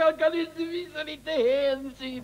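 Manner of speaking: high, slow hammed-up blubbering; mock crying. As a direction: whine it like a deserted child. Begging not to be left.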